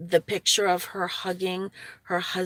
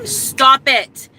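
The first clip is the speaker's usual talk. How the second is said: sudden loud snap at the dog; real irritated snap mid-sentence; sharp stop; 8 semitones higher, 9 dB louder than usual. Real, not acted. Snapping at crying dog.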